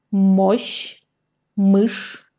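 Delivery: ordinary talk, nothing marked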